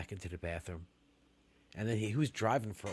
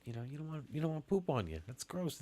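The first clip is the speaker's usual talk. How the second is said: ordinary talk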